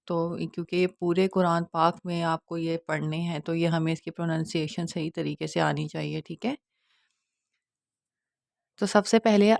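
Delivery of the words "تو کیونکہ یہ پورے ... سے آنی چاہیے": ordinary delivery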